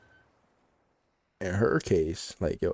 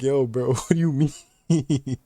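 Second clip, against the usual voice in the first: loud, higher, slowed by laughter; laughing mock disbelief; 4 semitones higher, 6 dB louder, much slower than usual. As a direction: protest through a laugh, drawn out. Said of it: "That's why I'm laughing".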